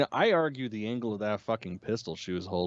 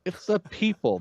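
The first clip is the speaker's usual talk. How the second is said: higher, firm retold rebuttal; imitated insistent correction; 8 semitones higher than usual. Man correcting girl in the forest, imitated insistence. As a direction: correct the naive guess emphatically. Acting Joel's answer to Ellie.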